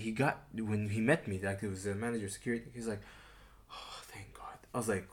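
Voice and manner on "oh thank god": whispered sigh of relief; retold relief. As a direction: breathe it out, imitating a relieved man. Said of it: "the guy came very stressed".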